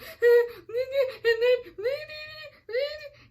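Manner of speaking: a crying laugh, high and loud; teary half-laugh half-sob; tearful laugh. Real, not acted. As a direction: laugh through the tears. Laughing while crying on call.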